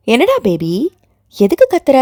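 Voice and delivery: playful teasing lift. A narrator's reading. Aunt teasing her nephew on the phone, playful lift. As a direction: tease the boy, voice rising. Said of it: "சீண்டலுடன் கேட்டாள்".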